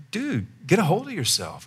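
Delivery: high-pitched exasperated reproach. Retold or imitated. Preacher replaying what he said to a reckless driver, exasperated. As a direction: scold him in a high, disbelieving voice.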